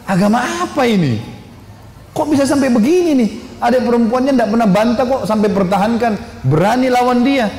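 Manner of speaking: high-pitched incredulous questioning; astonished. Retold or imitated. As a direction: retell his thoughts as a man amazed by such defiance. Umar astonished at his sister's defiance.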